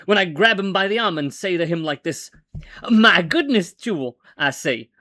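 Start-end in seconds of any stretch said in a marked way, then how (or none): 2.9-4.2 put-on slipping into a Southern accent. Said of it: "why am I going southern now?".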